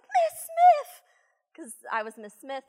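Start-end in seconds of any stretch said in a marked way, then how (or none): 0.0-1.0 high, loud eager call; excited child call. Retold or imitated. Boy calling his teacher over, excitedly and loud. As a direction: sing out the teacher's name like a thrilled kid.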